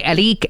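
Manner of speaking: "Alik", with L in place of the R; "Alik", imitating how the Spanish-speaking parents say Eric; family's pronunciation of Eric. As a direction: say Eric as "Alik", ending in a long "eek". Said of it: "they say Alik".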